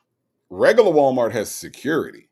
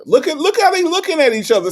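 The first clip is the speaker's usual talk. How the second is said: much higher, louder, insistent; excited, pitched up; 7 dB louder, faster than usual. Acted. Host teasing Say J about the clowns, excited and high. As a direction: push it up high, teasing Say J to look.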